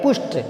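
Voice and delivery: पुष्ट with a clear retroflex ष्ट cluster; correct pronunciation. Shown as a demonstration. Teacher models पुष्ट for the exam students, crisp and deliberate. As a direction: say पुष्ट carefully as push-t. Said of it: "पुष्ट होता है".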